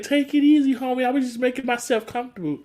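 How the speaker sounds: a hint of a laid-back roommate; lightly voiced Cedric. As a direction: brush it off breezily. Cedric voiced casually high.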